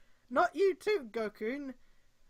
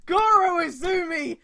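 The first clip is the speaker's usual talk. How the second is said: slow, much louder, higher booming declaration; mock proclamation; 7 semitones higher, 14 dB louder, much slower than usual. Reader loudly proclaims Goro's chivalry. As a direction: declare it like a grand slogan.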